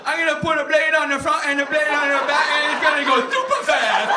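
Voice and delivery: put-on high, loud, over-excited kid voice; mock slow-witted kid. Kid boasts about building a helicopter, loud hammed-up child voice. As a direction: shout it proudly as a caricatured child.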